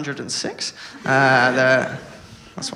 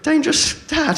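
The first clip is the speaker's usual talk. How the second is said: mock whiny reply; 12 semitones higher than usual. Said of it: "be like".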